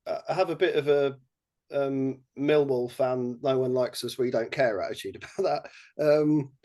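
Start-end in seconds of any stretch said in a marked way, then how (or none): none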